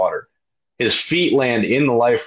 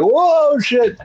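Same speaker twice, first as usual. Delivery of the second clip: much higher, louder startled yelp; 4 dB louder than usual. Retold or imitated. Rower thrown aboard, high startled yelp. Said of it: "I'm like".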